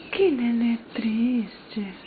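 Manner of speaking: slow, soft whisper of "Que nenê triste"; slow whispered cooing. Real, not acted. Repeats it whispered.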